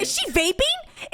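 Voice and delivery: high slow incredulous question; incredulous disbelief. Real, not acted. Disbelief at woman vaping.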